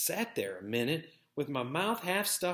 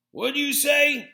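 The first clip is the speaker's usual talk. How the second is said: higher, louder slow call across the house; yelled question; 12 semitones higher, 7 dB louder, slower than usual. A narrator's reading. Brother yells after him across the house, higher and louder. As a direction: shout it after him as he leaves. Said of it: "Shane yelled".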